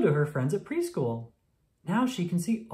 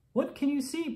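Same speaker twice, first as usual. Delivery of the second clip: much higher, teasing game voice; playful question; 11 semitones higher than usual. Acted. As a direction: ask it like a fun game for a small child. Doctor plays pirate game.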